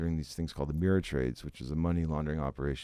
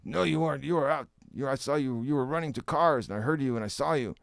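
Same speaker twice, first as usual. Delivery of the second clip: mock accusation from Glenn, pitched up; retold accusing boss; 7 semitones higher than usual. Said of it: "I was like".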